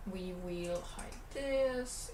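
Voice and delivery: normal talking voice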